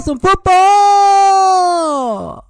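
high, loud drawn-out announcer call; announcer hype call. Pretend. Host hyping football season like a TV announcer. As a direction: belt it like a stadium announcer.

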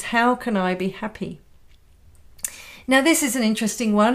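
ordinary delivery

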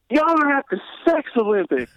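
high deadpan biker; biker's reveal. Retold or imitated. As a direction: deliver the punchline high as the biker. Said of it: "he's just like".

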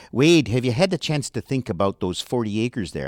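put-on a light Minnesota accent, reedy and up in the head; a mild nod to a Minnesota accent on Bill Macy's line; light Minnesota accent, quoting the Fargo movie. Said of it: "he had a line like".

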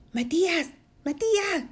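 high little voice calling out; tiny thin voice. Acted. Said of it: "le dijo una voz finita". (vocecita finita y aguda)

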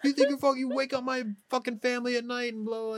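high-pitched mock angry rant; worked-up scolding, pitched well up; retold angry yelling. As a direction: imitate an irate neighbor yelling at kids. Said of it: "started yelling in my face".

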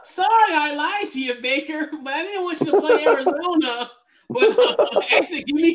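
high, laughing apology. Real, not acted. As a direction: giggle through the confession.

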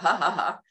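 deliberate laughing it off; easy put-on laugh; demonstrated laugh. Shown as a demonstration. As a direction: show a spoken ha-ha laugh. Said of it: "laugh it off".

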